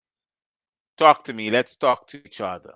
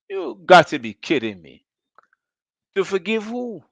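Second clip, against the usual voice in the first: normal talking voice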